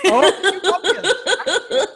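high squeal of laughter; laughing. Real, not acted. Called a sidekick by Angela, squealing laugh. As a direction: laugh high and loud in surprise.

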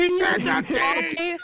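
high, slow, drawn-out mock shout; losing it. Imitating a kid in the theater, hammed-up excited shout. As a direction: act a kid going wild with excitement. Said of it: "kid that just fucking loses his shit".

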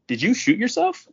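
very high-pitched disbelief; acted incredulous question. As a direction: imitate a buddy's stunned asking.

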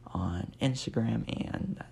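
ordinary delivery

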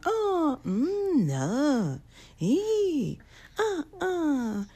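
high, wordless whimpers and moans; high put-on cartoon whimpering, no words; mock moaning noises. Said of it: "nobody talking? Everybody's just going".

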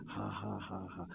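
low, quiet, deliberate chuckle; put-on laugh in separate beats; faith laugh. Shown as a demonstration. Preacher trusting a covenant-keeping God, deliberate faith laugh. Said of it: "Laugh in faith".

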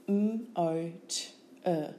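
M-O-T-E as separate phonemes; sound by sound. Shown as a demonstration. Teacher showing phonemes, M-O-T-E sound by sound. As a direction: sound out M-O-T-E with gaps.

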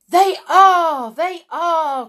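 very high, loud eager chorus; chorus of witches. Acted. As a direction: echo it like a crowd of thrilled witches. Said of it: "chorused the English witches".

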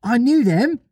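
put-on high, needling little-boy brag; childish show-off voice; John annoying the others. A narrator's reading. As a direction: pitch it up to wind the others up. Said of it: "John said to annoy the others".

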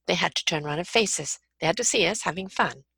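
normal talking voice

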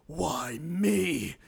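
lightly hammed-up dying lament; dying game guard. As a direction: draw it out like a mock death line. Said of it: "they're dying, there's the classic".

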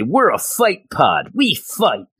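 hammed-up dim henchmen boasting; dumb thug voice. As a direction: play the dim cyberpunk goons. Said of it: "who then say".

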